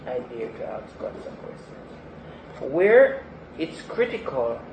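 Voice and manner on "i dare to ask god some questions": quiet repeat. Real, not acted. Repeated softer, falling.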